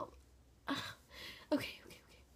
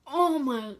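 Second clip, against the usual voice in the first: eating gross jelly beans; 9 dB louder than usual. Real, not acted. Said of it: "I can't do it".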